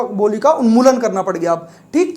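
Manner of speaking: ordinary talk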